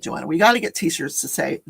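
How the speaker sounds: usual speaking voice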